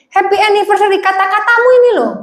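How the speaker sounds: high, sharp angry retort; angry wife. Acted. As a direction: throw the words back at him.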